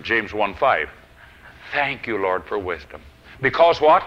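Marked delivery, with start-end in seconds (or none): 1.7-3.0 low, soft prayer, sinking at the end; hushed and falling away; quiet, reverent prayer. Retold or imitated. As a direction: repeat it gently and reverently. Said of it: "very quietly, I kept repeating audibly, reverently".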